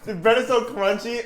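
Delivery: ordinary talk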